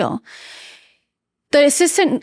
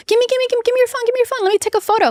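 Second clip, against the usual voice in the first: high, excited begging for the phone; playful high-pitched pleading; eager grab; 12 semitones higher, much faster than usual. Retold or imitated. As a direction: gimme gimme, bouncing with eagerness. Excitedly grabbing husband's phone.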